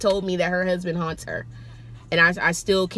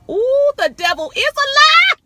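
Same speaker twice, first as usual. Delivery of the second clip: high, loud "Ooh, the devil is a lie"; shocked exclamation; 8 dB louder than usual. Retold or imitated. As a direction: cry it out in spooked disbelief. Reacting to ghost story.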